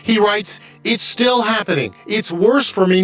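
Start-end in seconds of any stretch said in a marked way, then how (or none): none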